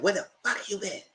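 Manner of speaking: high strained yell building at the end; shrieking demand on the phone; angry yell. Retold or imitated. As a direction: scream at the husband in a fury. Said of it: "She said".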